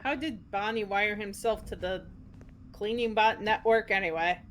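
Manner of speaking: ordinary delivery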